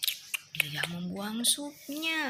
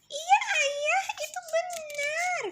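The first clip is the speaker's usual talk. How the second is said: put-on very high, louder little girl agreeing; daughter's voice; 4 dB louder, slower than usual. Daughter agreeing with her brother, very high girl voice. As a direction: chirp it like a small daughter. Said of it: "kata putrinya".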